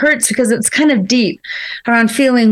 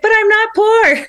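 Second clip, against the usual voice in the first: defensive; 9 semitones higher than usual. Retold or imitated. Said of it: "your individual feeling's like".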